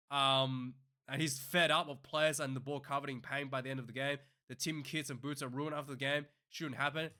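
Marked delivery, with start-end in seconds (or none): none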